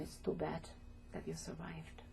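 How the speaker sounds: whispered, almost breathless, the cruel words; hushed whisper. Retold or imitated. Survivor retelling a soldier's cruel words, whispered. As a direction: let it drop to a bare whisper.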